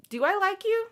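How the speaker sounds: hammed-up doubt about a character; unsure reader. Fan recalls first meeting Elena, mock-puzzled and high. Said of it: "be like".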